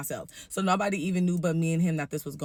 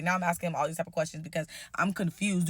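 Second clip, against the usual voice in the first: ordinary talk, nothing marked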